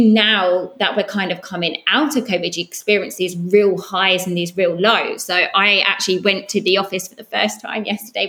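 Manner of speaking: ordinary talk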